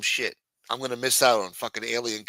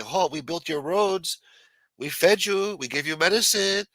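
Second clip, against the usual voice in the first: mock Chinese official; 7 semitones higher than usual. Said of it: "they're gonna say".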